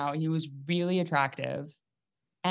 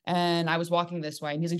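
ordinary talk

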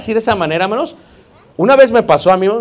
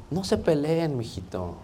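tender plea; 3 semitones lower, 13 dB quieter than usual. Acted. Tender plea to quarreling brothers.

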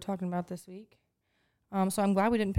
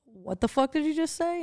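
shocked disbelief; 7 semitones higher than usual. Retold or imitated. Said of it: "And I said".

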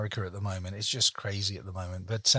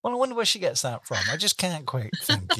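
playful tease; 7 semitones higher, 4 dB louder than usual. Real, not acted. Teasing guest about negotiation skills.

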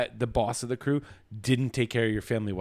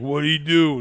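loud, raised-pitch demand; retold alarmed demand; 5 semitones higher, 9 dB louder, slower than usual. Father reacting to daughter's revelation, loud alarmed demand. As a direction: imitate a father demanding answers.

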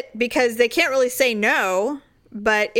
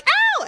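high, sharp "ow" yelp; sudden high cry of mock pain; 4 dB louder than usual. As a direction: yelp as if stung. Retold sting from nettles.

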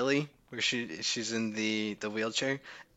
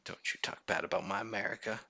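soft, hushed mock hurt; mock upset; 3 semitones lower, 9 dB quieter than usual. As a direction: play quietly wounded in defence of your country. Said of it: "I'm very upset right now".

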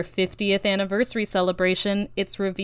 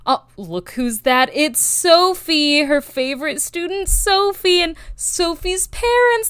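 hammed-up sarcastic excitement, going much higher and louder; mock gushing; 7 semitones higher, 3 dB louder than usual. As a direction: gush over the sappy ending, tongue in cheek. Mock gushing over sappy finale.

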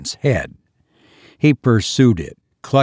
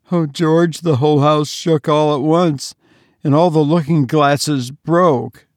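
wailed; 5 semitones higher than usual. Acted. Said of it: "wailed his mother".

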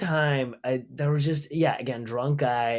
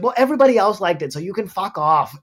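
quick, louder retold comeback; raised-pitch mock snap, rushed at the start; snapping back; 4 semitones higher, 5 dB louder than usual. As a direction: fire it straight back at a heckler. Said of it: "I snapped right to him".

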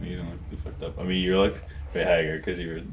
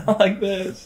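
laughing despair; 11 semitones higher, slower than usual. Pretend. Mock plane crash, laughing.

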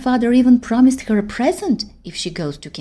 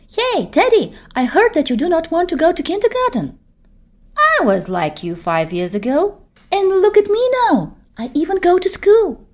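higher, lively schoolgirl voice boasting to a friend; a proud big-girl voice, raised high; older girl voice; 8 semitones higher, 5 dB louder than usual. Acted. Gabby's voice in the story.

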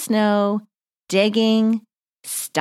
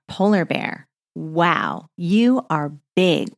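normal talking voice

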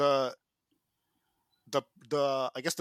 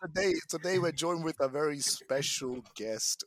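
usual speaking voice

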